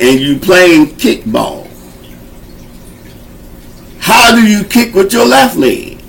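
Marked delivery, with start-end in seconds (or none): none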